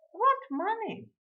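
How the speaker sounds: high, incredulous lady customer; imperious lady's challenge. A narrator's reading. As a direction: question it high, loud and drawn out. Acted lady challenges the shopkeeper.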